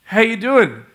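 loud, high, sudden greeting; acted over-bright loud hello; retold calling out. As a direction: call out to rouse a sleeping driver. Calls out to the sleeping driver.

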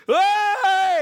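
a high, loud party yell; rowdy shout. Retold or imitated. Teammate turns on the light and yells a high party whoop. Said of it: "one of the guys turns on the light in the room and goes".

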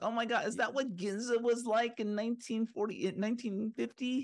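teasing fans with a high, breathless mock gush. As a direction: gasp over old trains like an overexcited fan. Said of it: "They're like".

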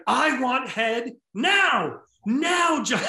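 high, slow full-volume yell; shouting. Retold or imitated. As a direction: bellow it across the kitchen. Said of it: "full volume".

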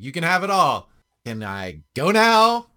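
loud, high-pitched exasperation; exasperated. Acted. Player throws money at goblin leader, loud exasperated voice. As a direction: fling the words out, drawing out the question.

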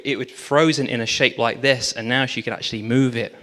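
usual speaking voice

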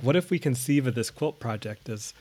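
ordinary delivery

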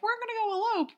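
mock character voice. Mocking characters' plan.

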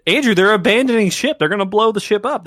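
loud, high exasperated explaining. Real, not acted. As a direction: nearly shout it, fed up.

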